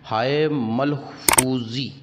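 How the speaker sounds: ordinary talk, nothing marked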